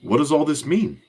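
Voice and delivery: put-on a light Brazilian accent on a worried question; young Roberto asks the others in a light Brazilian accent. Roberto puzzled, in a mild Brazilian accent. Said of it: "That's my Brazilian accent".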